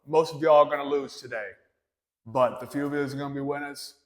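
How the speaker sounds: put-on loud tough-guy Boston accent, pumped up. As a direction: bellow it like a Boston gym coach. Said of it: "I'm gonna try and do it in my best Boston accent".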